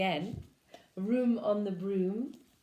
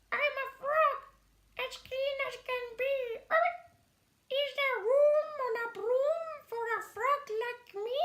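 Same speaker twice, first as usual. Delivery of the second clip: high, fast frog voice; croaking frog; 4 dB louder than usual. Acted. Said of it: "said with a croak".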